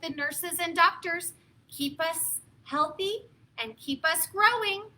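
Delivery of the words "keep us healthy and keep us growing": plain reading voice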